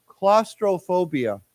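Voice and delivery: "Claustrophobia" drawn out slowly and clearly; word modelling. Shown as a demonstration. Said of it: "here's a very long word".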